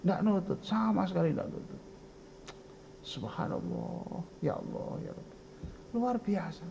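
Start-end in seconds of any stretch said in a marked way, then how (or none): 3.1-6.7 soft, whispered exclamation of praise; hushed awe. Real, not acted. Preacher marvelling at God's wonders, hushed awe.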